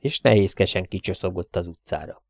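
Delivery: plain reading voice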